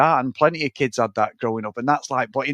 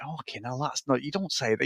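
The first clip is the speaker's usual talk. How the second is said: muttered disbelief; 8 dB quieter than usual. Retold or imitated. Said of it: "I'm like".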